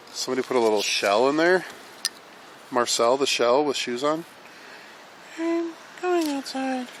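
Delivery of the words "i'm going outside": very high, slow squeak; squeaky voice. Pretend. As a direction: squeak it like a cartoon. Comic high voice.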